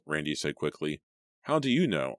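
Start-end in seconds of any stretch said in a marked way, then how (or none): 1.4-2.1 higher, pointed challenge; a mildly raised demand. A narrator's reading. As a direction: shoot the question back, voice up. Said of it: "I demanded".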